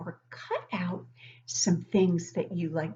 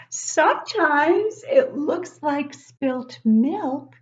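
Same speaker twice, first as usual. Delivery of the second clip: dramatic reveal; 7 semitones higher, 9 dB louder, slower than usual. Acted. Building up to the story's reveal.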